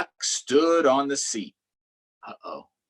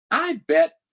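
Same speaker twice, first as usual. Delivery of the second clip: high and loud, Duck bursting with his idea; a hyped-up little duck; excited duck voice; 7 dB louder than usual. Acted. Storyteller voicing Duck for listening kids, high and loud. Duck's first line, high.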